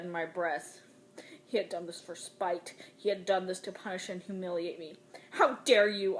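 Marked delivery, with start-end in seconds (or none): 5.3-6.2 loud, furious spit of words; a mother spitting rage at her son; spat in fury. A narrator's reading. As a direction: hurl it at the child in outrage. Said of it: "I spat".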